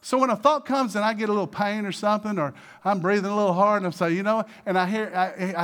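loud, high and urgent; rally the congregation against fear; preaching at full force. Acted.

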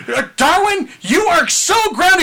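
hammed-up angry mother voice; scolding mom. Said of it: "And then it's like".

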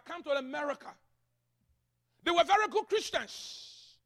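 loud, high-pitched pastoral proclamation; forceful preaching. Real, not acted. African pastor telling church about immigrant Christians, forceful preaching. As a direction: preach it out to the whole room.

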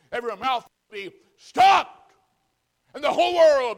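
full-force preaching shout. Real, not acted. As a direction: thunder it from the pulpit. Preaching climax on judgment.

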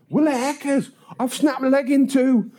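high-pitched mock exasperation; indignant protest. Paperboy answering tea and brandy offer, indignant. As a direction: retort in a high, outraged wail. Injured boy protesting dismissal.

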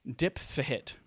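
Dipsahit as French, dip-sa-HEET, not dipshit; French-style name. Pretend. Correcting how people pronounce it, mock-French Dipsahit. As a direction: say Dipsahit low and soft, split into three syllables. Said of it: "it's French".

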